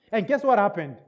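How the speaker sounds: normal talking voice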